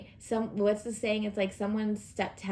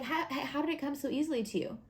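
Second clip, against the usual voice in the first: higher-pitched stammering question; acted bewilderment, pitched up; baffled onlookers; 6 semitones higher, faster than usual. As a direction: imitate someone incredulous and impressed. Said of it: "and they're like".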